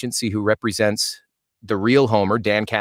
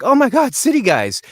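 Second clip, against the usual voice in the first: excited recognition; 12 semitones higher, 4 dB louder than usual. Retold or imitated. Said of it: "Topher Grace immediately was like".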